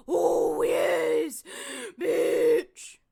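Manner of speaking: shocked outburst. Real, not acted. Shocked at reveal on screen.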